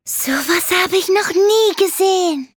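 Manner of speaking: hoch und begeistert gerufen. A narrator's reading. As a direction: staunend ausrufen wie ein Kind. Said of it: "rief er begeistert". (begeistert rufend)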